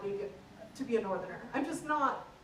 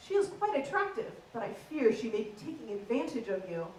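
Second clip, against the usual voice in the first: prim, proper received pronunciation; genteel posh translation of Essex slang; posh English accent; 2 semitones lower than usual. Acted. Comic translating the lad's warning, in prim posh English. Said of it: "average conversation goes a little bit like this".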